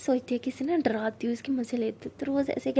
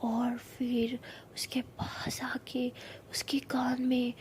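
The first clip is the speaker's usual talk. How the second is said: creepy ghost story whisper; 5 dB quieter, slower than usual. Acted. Rose builds ghost story tension.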